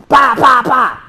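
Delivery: loud, high shouted gunshot noises; mock gunfire. Instructor mocking a trigger-happy crowd, shouted gunfire sounds. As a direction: fire off sharp imitation shots.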